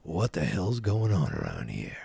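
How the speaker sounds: low, creaky mock bafflement; hammed-up bewildered grumble; Biff. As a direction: drop into a rough, low villain voice. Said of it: "Biff's like".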